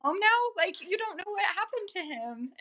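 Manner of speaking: exasperated quote. Retold or imitated. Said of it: "I was like".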